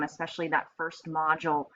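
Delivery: normal talking voice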